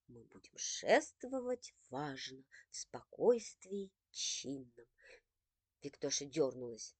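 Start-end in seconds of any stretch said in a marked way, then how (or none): 0.1-4.9 low, slow pompous recital; mother parodying a stately march, slow and low; mock solemn. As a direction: declaim it grandly in jest. Mother mock-solemn quoting verse.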